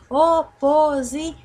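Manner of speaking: slow, loud "O-po-si-ÇÃO", final syllable held; stressed last syllable. Shown as a demonstration. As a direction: break up "Oposição" and hold the final ÇÃO. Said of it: "Porque a última sílaba foi a mais forte".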